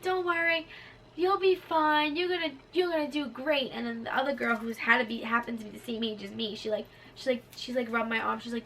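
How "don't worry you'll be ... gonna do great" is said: high-pitched imitated nurse encouragement; reassuring. Nurses comforting scared patient before surgery, reassuring.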